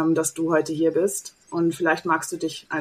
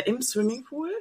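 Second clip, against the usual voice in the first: laughing; 9 semitones higher than usual. Real, not acted. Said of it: "Das war ein Opener".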